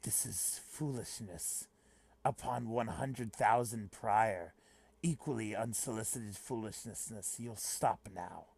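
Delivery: deep, hushed anime-dub creep; sweaty creep. Acted. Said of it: "Just make him a sweaty creep".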